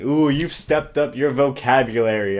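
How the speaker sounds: usual speaking voice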